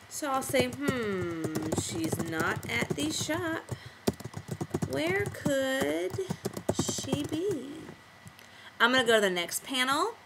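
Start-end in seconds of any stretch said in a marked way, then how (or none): 0.7-7.7 slow, halting words while typing; slow dictation to oneself; typing aloud. Shown as a demonstration. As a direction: draw it out with gaps, composing as you type. Typing thought balloon aloud.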